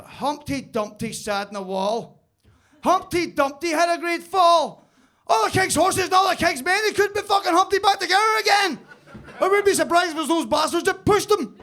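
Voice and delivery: nursery rhyme building to a high, loud shout; escalating rant. Acted. Northern Irish workmate doing a bedtime story, escalating into a shouted rant. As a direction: start measured, then blow up into a roaring mock rage. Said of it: "It just gets faster and faster".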